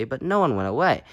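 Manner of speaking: ordinary delivery, nothing marked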